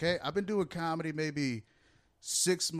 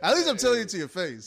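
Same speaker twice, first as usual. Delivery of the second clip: laughing; 8 semitones higher, 4 dB louder than usual. Real, not acted. Teasing through laughter.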